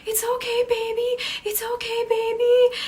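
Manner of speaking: high, loud pleading; mimicking a frantic girlfriend chasing him. Tara chasing her angry boyfriend, high pleading. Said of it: "who gets up and it's like".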